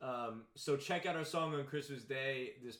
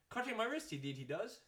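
mock Irish accent; 10 semitones higher than usual. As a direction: mimic an Irish lilt, starting high. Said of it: "that was kinda Irish".

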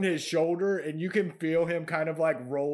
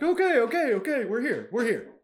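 panicked goblins; 7 semitones higher, faster than usual. Acted.

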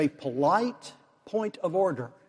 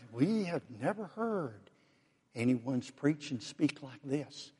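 much softer, slow and hushed in wonder; awed hush; 2 semitones lower, 10 dB quieter than usual. Acted. Temple officers telling the Pharisees about Jesus, hushed and awed. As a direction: say it quietly, still stunned by what you heard.

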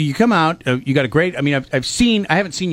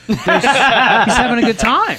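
laughing; 9 semitones higher, 4 dB louder than usual. Real, not acted. Laughing while teasing friend.

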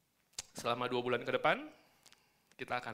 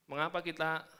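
ordinary talk, nothing marked

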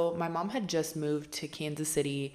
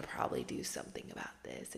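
whisper; 13 dB quieter, faster than usual. Real, not acted. Whispered end of plea.

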